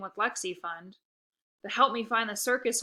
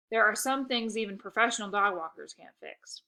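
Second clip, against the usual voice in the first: plain reading voice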